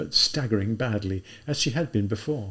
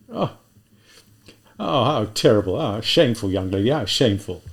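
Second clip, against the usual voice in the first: put-on an older policeman clucking over the girl; policeman character; 5 dB louder than usual. A policeman pitying the drunk girl, raised voice. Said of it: "shake his head in embarrassment".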